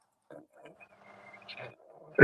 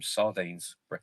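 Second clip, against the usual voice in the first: mumbled sardine offer; 3 semitones lower, 10 dB quieter, slower than usual. Retold or imitated. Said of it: "just goes up to him".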